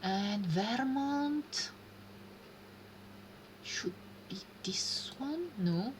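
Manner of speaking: slow, voiceless thinking aloud; whisper to herself. Real, not acted. Placing Vermont on the map, whispering the guess to herself. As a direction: breathe the guess out slowly.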